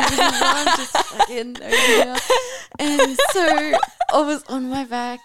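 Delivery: high, slow laughing talk. Real, not acted. Describing excited Adam and her pain, laughing. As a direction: giggle through the retelling.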